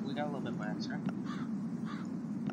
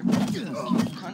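angry yell; 13 semitones higher, 11 dB louder than usual. Real, not acted. Enemy attack, raging.

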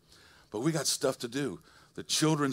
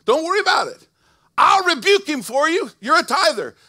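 much higher, much louder booming voice of God; God's voice, booming; 14 dB louder than usual. Acted. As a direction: proclaim it as God, soaring over the church. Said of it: "He goes".

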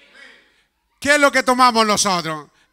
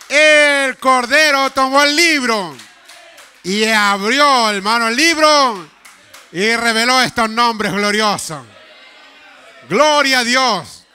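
loud, slow, drawn-out preaching; full-force preaching; 6 dB louder than usual. Acted. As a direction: proclaim it to the whole church. (predicación a todo pulmón, lenta y fuerte)

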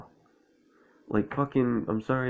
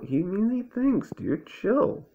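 high mocking voice; 12 semitones higher, slower than usual. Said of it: "voicing Owl mockingly".

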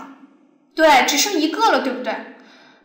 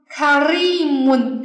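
karimun said slowly, "ri" held long and louder; stress on RI; 4 dB louder, much slower than usual. Shown as a demonstration. Teacher shows where the word's stress falls, stress on "ri" in karimun. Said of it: "重音在리上面".

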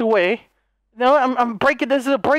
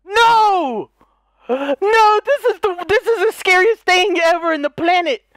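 panicked; 6 semitones higher than usual. Real, not acted. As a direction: cry out in real panic. Panicking at scare.

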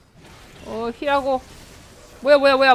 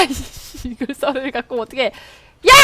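ordinary talk, nothing marked

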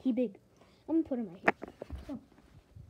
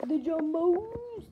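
much higher, louder, slow booming reveal; hype announcer; 7 semitones higher, 9 dB louder, much slower than usual. Shown as a demonstration. Repeats title as big reveal.

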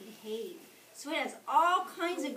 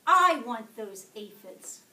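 much louder, insistent claim; grouchy demand; 13 dB louder than usual. A narrator's reading. Grouchy ladybug demands the aphids from its rival, loudly. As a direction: stake your claim to the aphids.

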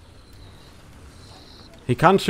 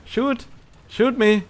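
goading; 7 semitones higher than usual. Real, not acted. Goading a tank to fire.

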